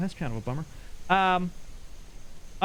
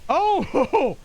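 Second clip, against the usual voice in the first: startled cry; 9 semitones higher, 5 dB louder than usual. Real, not acted. Monster appears again.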